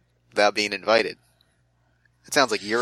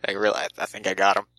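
ordinary delivery

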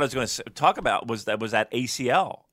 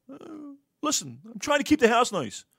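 imitating wife; 12 semitones higher than usual. Telling his co-host how his wife defends her tidying, in a high mock voice. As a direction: mimic the wife explaining herself, a bit prissy.

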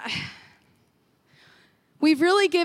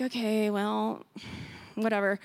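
deflated; 4 semitones lower, 6 dB quieter than usual. Retold or imitated. Deflated unpacking ugly toys.

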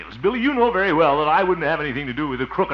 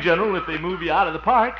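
normal talking voice

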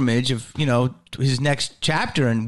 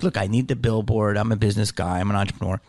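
low-voiced mock businessman; businessman act; 8 semitones lower, faster than usual. Comic justifying himself to his agent, mock businesslike low voice. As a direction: drop it low and self-important, quoting yourself. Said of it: "I was just like".

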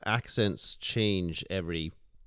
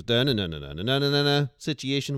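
Northern Irish lilt, louder and pitched up; harsh Northern Irish; 6 semitones higher, 5 dB louder than usual. Shown as a demonstration. Showing friends how Northern Irish intonation goes, harsh Northern Irish accent. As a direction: punch out the Northern Irish tune in nonsense syllables. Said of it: "it can be a bit more harsh".

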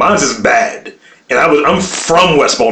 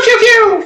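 much higher "pew, pew" like toy gunfire; pew pew sound effect; 3 dB louder than usual. Pretend. Giving code words to the co-host, toy-gun sound. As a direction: fire off "pew, pew" like a toy laser. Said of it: "code words I have".

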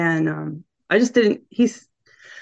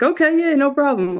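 breezy higher mock of him; lightly voiced boyfriend; 7 semitones higher than usual. Mimicking her boyfriend's careless reply, lightly higher. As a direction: toss it off like he doesn't care. Boyfriend brushes off ring joke.

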